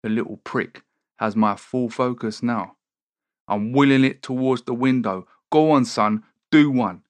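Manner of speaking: ordinary talk, nothing marked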